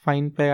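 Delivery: Finpeg with first syllable like "fine"; long-i pronunciation. Shown as a demonstration. Guest trying out the company name, Finpeg as "fine-peg". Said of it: "I don't know the pronunciation".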